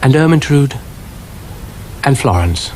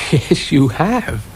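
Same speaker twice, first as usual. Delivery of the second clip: trying not to laugh; 5 semitones higher, slower than usual. Acted. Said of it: "trying not to laugh".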